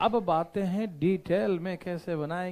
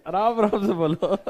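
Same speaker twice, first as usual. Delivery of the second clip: loud laughing imitation of a teacher; laughing quote; 4 semitones higher, 10 dB louder than usual. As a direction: burst into laughter while quoting the teacher. Retold teacher, laughing at memory. (हँसते हुए ज़ोर से teacher की नकल)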